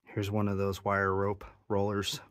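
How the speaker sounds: normal talking voice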